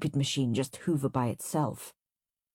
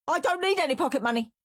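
shriek; 11 semitones higher, much faster than usual. Acted. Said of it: "shrieked Henry".

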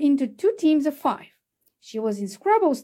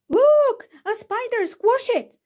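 high, loud panicked scream; 11 semitones higher, 3 dB louder than usual. A narrator's reading. Child spots the spider and screams to squash it. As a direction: shriek it in disgust at the spider. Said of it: "screamed someone".

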